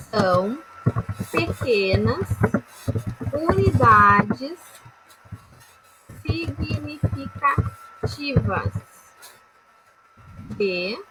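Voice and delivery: stretched out for note-taking; slow dictation. Shown as a demonstration. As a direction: spell it out slowly for students. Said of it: "teacher dictating definition slowly".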